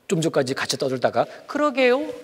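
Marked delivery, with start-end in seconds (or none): 1.5-2.1 high, sing-song innocent reply; feigned innocence. Acted. Child pretending innocence to the teacher, high sing-song. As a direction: play dumb in a squeaky innocent voice. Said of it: "모르는 척하는 거짓말".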